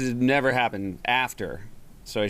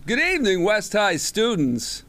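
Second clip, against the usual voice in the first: teacher impression; 11 semitones higher, 4 dB louder than usual. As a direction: greet the school like the beloved law teacher. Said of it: "I did an impression of him".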